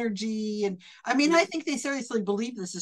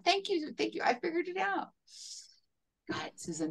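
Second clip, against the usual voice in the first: whispered aside; 9 semitones higher, 10 dB quieter than usual. Real, not acted. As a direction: breathe it softly to one person. Aside to Susan.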